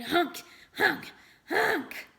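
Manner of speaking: rising, loud nasal honks; honking nose blows. Acted. Boy blows his nose to grant a wish, honking. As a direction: honk it out like a goose.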